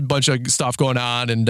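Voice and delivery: ordinary talk, nothing marked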